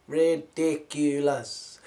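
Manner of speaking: Ridiculous stretched slow with a falling end; slow, scornful Ridiculous; drawn-out ridiculous. Acted. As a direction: drag out Ridiculous in mock disbelief. Repeats the word, drawn out.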